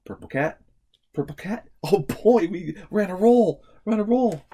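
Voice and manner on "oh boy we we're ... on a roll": higher and louder cheering; thrilled cheer about being on a roll; excited. Real, not acted. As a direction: celebrate the streak. Celebrating a right answer.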